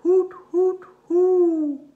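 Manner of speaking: very high, very loud hooting; loud high owl hoots. Acted. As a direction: hoot like an owl. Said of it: "an owl going".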